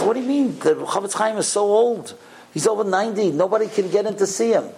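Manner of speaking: high-pitched, exasperated objection; incredulous protest. Retold or imitated. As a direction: protest as if it were impossible. Woman objects to stranger's suggestion.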